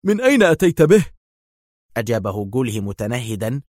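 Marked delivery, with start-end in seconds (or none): 0.0-1.0 high, tense voice for Dar; nervous high demand; tense question. A narrator's reading. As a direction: Dar asks Gula tensely where he got him. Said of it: "وسأله في توتر عصبي".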